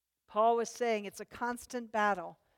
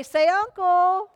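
higher, louder, slow taunt; taunting older brothers; 7 semitones higher, 9 dB louder, slower than usual. Retold or imitated. Older brothers taunting their pinned little brother, slow loud jeer. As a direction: drag it out like a bully brother. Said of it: "and they'd say".

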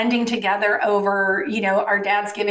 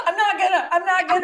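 higher, laughing through a mock sob; mock fighting tears; 8 semitones higher, faster than usual. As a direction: pretend to choke back tears while laughing. Mocking holding back tears.

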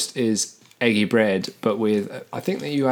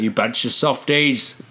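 mock insult; slower than usual. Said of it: "Be like".

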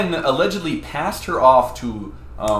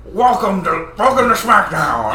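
higher, louder hammed-up host greeting; Vince announcer voice; 5 semitones higher, 6 dB louder, faster than usual. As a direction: play a big wrestling show host. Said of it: "he just was like".

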